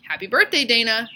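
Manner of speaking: bright, loud fake cheer; mock-sweet birthday greeting. A narrator's reading. Bully John hands Dana a prank present, fake cheerful. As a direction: sell the trick with a grin. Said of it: "said John".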